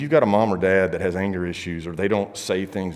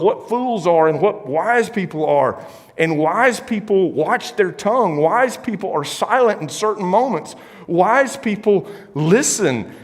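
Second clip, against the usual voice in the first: slow emphatic preaching; 8 semitones higher, 3 dB louder, slower than usual. Acted.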